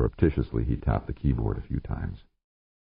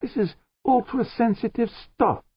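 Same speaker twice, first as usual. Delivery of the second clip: much higher, insistent; George's voice. Acted. George pressing the chief, much higher. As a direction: stress it in a raised voice.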